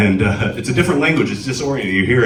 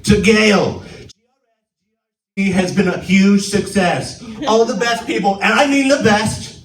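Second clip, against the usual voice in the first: higher-pitched, boastful Trump reading; Trump letter voice; 7 semitones higher than usual. Retold or imitated. Reading Trump's letter to the aunt in higher-pitched mock Trump voice. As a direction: puff up every best like Trump. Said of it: "the president wrote her a letter".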